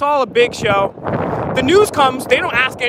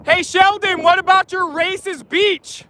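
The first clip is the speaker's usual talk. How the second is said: high, slow raised call; calling from far away; 5 semitones higher, slower than usual. Real, not acted. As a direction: call out to him from a distance. Protester calling out to a politician.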